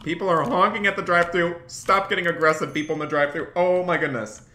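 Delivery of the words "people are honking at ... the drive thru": high, loud, fast playful panic; mock panic. Real, not acted. Streamer overwhelmed by a busy restaurant shift, mock panic. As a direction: cry for help, half joking. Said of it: "I'm feeling overwhelmed here".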